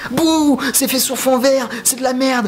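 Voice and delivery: very high, loud whining jeer; booing complainer in an exaggerated high-pitched voice; mock heckler. Mimicking film haters booing the green screen, high mock heckle.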